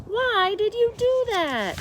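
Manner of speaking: high, slow exasperated yell; yelled question. A narrator's reading. Mister Rogers scolding Amelia Bedelia, high slow yell. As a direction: demand it, baffled and cross. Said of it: "yelled Mister Rogers".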